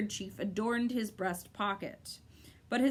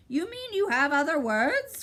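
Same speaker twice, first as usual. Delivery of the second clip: high, louder gleeful bird; happy cry; 6 semitones higher, 6 dB louder than usual. A narrator's reading. Bird delighting in Milo's slip, high and glad. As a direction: pounce on the words gleefully. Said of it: "cried the bird happily".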